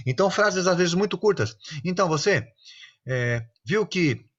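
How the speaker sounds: ordinary talk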